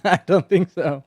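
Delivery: laughing while saying it; chuckling through the words. Real, not acted. Laughing at the absurd idea.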